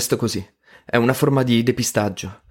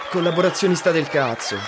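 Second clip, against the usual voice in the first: higher, heckling insult thrown at the speaker; mild mock yell; 6 semitones higher than usual. Student in the back heckling the speaker, in a mild mock yell. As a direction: toss the insult up like a heckler, not full volume. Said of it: "Un altro gli grida".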